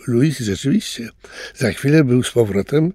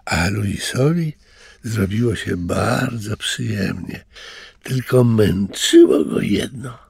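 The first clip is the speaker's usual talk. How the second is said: ordinary delivery, nothing marked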